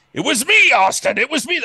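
gleeful boast. Acted. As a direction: crow it out like a proud villain. Gleefully claims blame to Austin.

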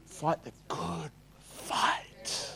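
slow, soft, drawn-out hush. Shown as a demonstration. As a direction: let it sink in, quietly and slowly. Quote repeated hushed.

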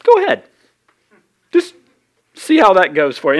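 Elijah daring the prophets to try, with a teasing lilt; mock taunt. Pastor voicing Elijah taunting prophets of Baal, sarcastic. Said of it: "Elijah's like".

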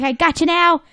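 triumphant cry. Acted. SpongeBob catching Plankton on the roof, triumphant cry. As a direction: pounce on it, mock triumph. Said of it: "he's like".

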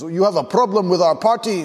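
high, challenging mock French accent; French party host. French host confronting an uneasy guest, mock French accent. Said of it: "took me to the corner".

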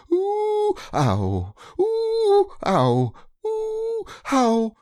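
very high hooted "ooh", dropping to "ow"; bouncing "ooh" and "ow". A narrator's reading. Piglet bounced all the way to Kanga's house, seesawing oohs and ows. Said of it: "And he was saying".

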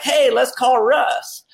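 high, slow, drawn-out eager suggestion; always excited friend. Retold or imitated. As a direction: imitate an upbeat pal pitching an idea. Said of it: "he's just always excited".

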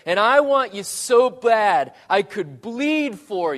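loud, high, aching cry of love; impassioned plea. Acted. God calling after his runaway people, loud and pleading. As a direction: call it out into the street, pleading.

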